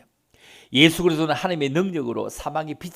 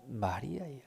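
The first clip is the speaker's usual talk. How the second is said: quiet, tender "마리아야"; soft call; 2 semitones lower, 10 dB quieter, slower than usual. Acted. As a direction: drop to a gentle call. Soft call inside loud refrain. (부드럽게 부르듯)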